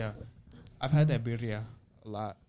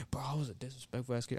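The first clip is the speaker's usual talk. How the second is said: whispering; 8 dB quieter, faster than usual. Retold or imitated. Retelling what he said to mom.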